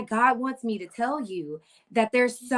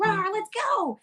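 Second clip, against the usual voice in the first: higher pitched mock rallying cry; hyped cheer; 11 semitones higher than usual. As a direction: pump up the team.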